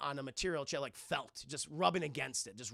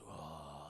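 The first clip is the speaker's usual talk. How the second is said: eerie moan; 13 dB quieter than usual. Retold or imitated. Creepy moving sound.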